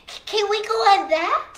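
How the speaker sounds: high, hopeful pleading; eager child asking. A narrator's reading. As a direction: beg to go on the ride. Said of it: "he said".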